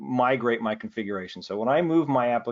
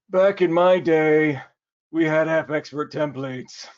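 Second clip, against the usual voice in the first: put-on slow, higher creaky old-timer voice; old man voice; 5 semitones higher, 5 dB louder, slower than usual. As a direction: play a grumpy old man reminiscing. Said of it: "Is that your old man voice?".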